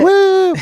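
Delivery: high, loud "woo"; long, piercing hype whoop; whooping cheer. Pretend. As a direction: let out a high crowd-style whoop. Cheer after the headline.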